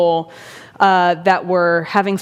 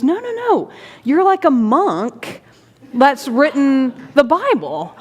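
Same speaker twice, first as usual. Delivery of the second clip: high-pitched imitation of an insistent admirer; insistent admiration; 6 semitones higher than usual. Older gentleman likens the writer to a monk, insistent. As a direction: overrule the denial, stretching the words out.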